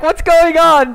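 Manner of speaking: mock puzzled teammate; Saint's confused question. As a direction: act baffled. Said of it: "and Saint's like".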